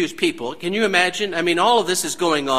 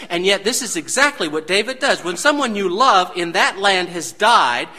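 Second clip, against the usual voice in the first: ordinary talk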